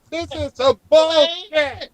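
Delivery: high, slow muttered grumble. Pretend. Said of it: "You hear".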